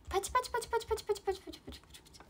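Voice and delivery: fast, light chant of clap sounds; soft, fast mock applause for the viewer; spoken clapping. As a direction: patter out applause like a quick drumroll.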